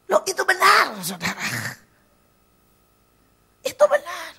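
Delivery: high, loud burst of insistence; emphatic exclamation. Real, not acted. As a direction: cry it out to convince the congregation. Preacher insists emphatically to congregation. (seruan tegas dengan suara tinggi dan keras)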